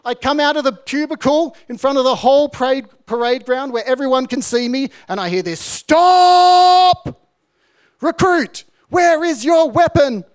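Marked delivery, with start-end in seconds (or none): 5.6-10.2 high, loud barking drill sergeant; drill corporal shout. Retold or imitated. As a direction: bellow it across the parade ground. Corporal yells across parade ground.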